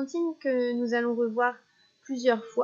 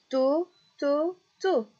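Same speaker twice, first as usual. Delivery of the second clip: to, to, to chanté plus haut; echoed syllable; 5 semitones higher, 3 dB louder, slower than usual. Shown as a demonstration. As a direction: fais l'écho de la syllabe finale. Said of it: "répéter trois fois la dernière syllabe". (to répété en écho, plus aigu)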